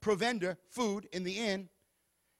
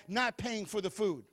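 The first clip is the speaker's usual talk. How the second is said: ordinary talk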